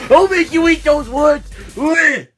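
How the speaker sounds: high, shouted battle threat; furious threat. Acted. Hero lunges at his rival after an insult, furious. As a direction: yell it at full pitch.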